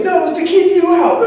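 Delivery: high, loud mock angry homeowner; upset lady. Telling off a door-to-door student, high and shrill-voiced. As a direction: squeal it like the cross lady at the door.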